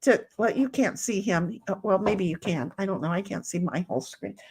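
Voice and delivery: normal talking voice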